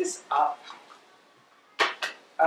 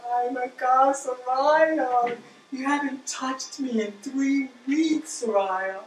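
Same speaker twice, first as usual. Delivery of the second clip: Papi Food Processor; 8 semitones higher, 3 dB louder, much slower than usual. Pretend. Food processor complains to its owner about neglect, high pretend voice. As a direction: pretend whiny talking appliance, pitched up. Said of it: "right now he's saying".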